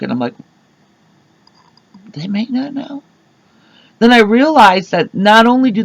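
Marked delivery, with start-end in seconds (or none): 2.1-3.0 hushed, higher puzzled aside; retold bewilderment. Puzzled customer overhearing the order, hushed bewilderment. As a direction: ask it softly, pitched up in confusion.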